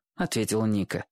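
ordinary delivery

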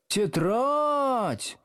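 very high, drawn-out protest; indignant outcry. A narrator's reading. Girls in the front desks protesting, high and indignant. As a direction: wail the word in outrage. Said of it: "дружно возмутились девицы".

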